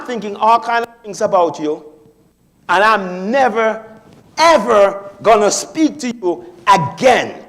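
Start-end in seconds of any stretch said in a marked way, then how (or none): 2.7-7.4 slow, louder, leaning on never and ever; emphatic vow. Retold or imitated. Angry friend vowing never to speak again, slow and loud. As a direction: swear it off for good, slowly.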